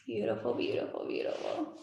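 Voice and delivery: hushed, approving murmur; soft affirmation. Real, not acted. Guide praising the meditators, hushed and soft.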